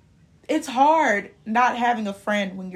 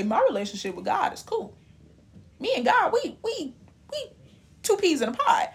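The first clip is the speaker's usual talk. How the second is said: playful boast; 7 semitones higher than usual. Retold or imitated. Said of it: "I'll be the first one to say".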